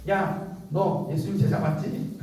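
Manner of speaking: slow, accusing question in a hint of a child's voice; little servant girl. Servant girl challenging Peter, lightly acted suspicion. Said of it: "그 여자 꼬마 종이 베드로한테 가서".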